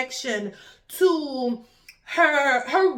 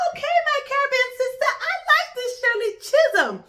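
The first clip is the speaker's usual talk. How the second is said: excited exclamation; 11 semitones higher than usual. Retold or imitated. Vlogger retelling her thrilled reaction to learning Shirley's roots, excited high gush. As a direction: gush with delight at discovering kinship. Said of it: "So I said".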